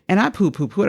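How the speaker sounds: ordinary talk, nothing marked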